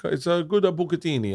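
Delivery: lilting mock-Italian recommendation; Italian accent. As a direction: imitate an Italian waiter's accent. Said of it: "He's an Italian guy".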